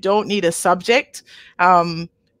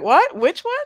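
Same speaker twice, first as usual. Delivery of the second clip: confused learner; 7 semitones higher than usual. Retold or imitated. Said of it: "at first you're like".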